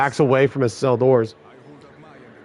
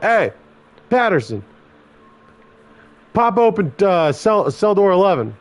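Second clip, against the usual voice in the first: raised, spaced-out call to a colleague; 6 semitones higher than usual. Acted. Guard calling the tier officer to open a cell, calling out. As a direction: call an order down the tier, imitated.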